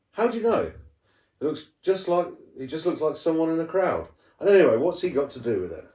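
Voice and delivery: lightly voiced girl, doubting and quick; sister Kat's voice.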